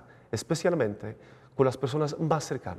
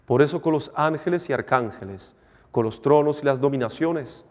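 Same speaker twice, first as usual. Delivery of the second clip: measured priestly recitation; formal liturgical prayer; 6 dB louder than usual. Acted. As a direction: intone it like a priest at mass. Priest recites eucharistic preface.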